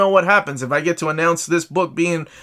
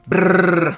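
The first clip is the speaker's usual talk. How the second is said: a rolled R like a drum roll; 4 semitones higher, 4 dB louder than usual. Shown as a demonstration. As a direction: roll your R's for a drum roll. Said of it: "that's my drum roll, or me just rolling my R's".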